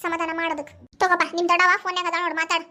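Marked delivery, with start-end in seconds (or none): none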